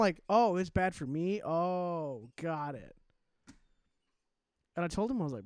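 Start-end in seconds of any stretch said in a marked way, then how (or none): 0.3-3.0 sarcastic, drawn-out "oh"; exaggerated sarcastic acceptance; mock realisation. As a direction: feign slowly getting the point. Sarcastic comeback to the hypocrite.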